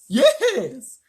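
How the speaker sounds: high, loud bear; old bear voice. A narrator's reading. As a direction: blurt it out as a big eager bear. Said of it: "said the bear".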